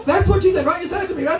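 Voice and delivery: ordinary talk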